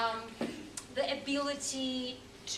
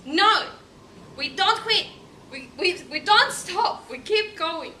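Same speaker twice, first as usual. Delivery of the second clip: emphatic rallying quote; 7 semitones higher, 11 dB louder than usual. Retold or imitated. Echoing film line as motto.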